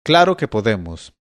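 mild shout from above; shouted reply. A narrator's reading. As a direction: call it down to him, restrained. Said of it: "volvió a gritarle a Bastián".

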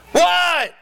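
very high, sharp yell; exasperated father yelling. Acted. Father answering his son a third time, yelling. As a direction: yell back at the end of patience. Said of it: "Father yells back".

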